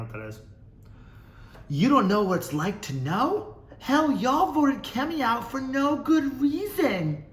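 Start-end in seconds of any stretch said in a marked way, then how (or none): 1.7-7.2 high, drawn-out put-on outrage; mock-indignant commenter. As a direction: wail the comment in an exaggerated high voice.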